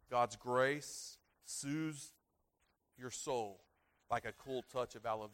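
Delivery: very slow, drawn-out delivery; slow soothing. Acted. Pastor to congregation, very slow soothing phrase. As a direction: stretch it out gently.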